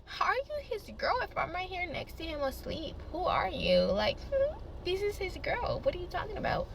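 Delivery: high, soft, hushed retort; imitated rival girl. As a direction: play her as a small quiet voice. Voices the other girl replying.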